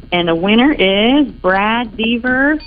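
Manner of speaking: hyped giveaway announcement. Real, not acted. Announcing the winner.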